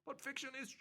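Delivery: high mocking shriek from the rafters; mock scream. Woman in the rafters cursing at Tarantino, high mock scream. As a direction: shriek it like an outraged fan. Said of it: "And she's screaming".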